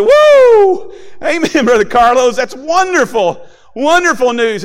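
a high, loud whoop, then joyful praise; excited whoop. Retold or imitated. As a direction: cheer the good news out loud. Cheering a convert's testimony.

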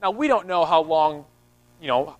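ordinary delivery

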